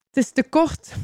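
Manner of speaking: high complaining call. A narrator's reading. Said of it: "riep de vage figuur". (hoog, klagend roepend)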